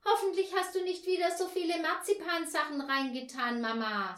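put-on high child's voice; child Paul. A narrator's reading. Paul pleads with Mama about the sweets, high child voice. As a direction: voice a fussy boy, pitched high.

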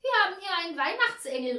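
fast, high, loud announcement; calling out. A narrator's reading. Hostess to her party guests, calling out loudly. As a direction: call it out rapidly to the whole room. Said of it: "rief die Frau".

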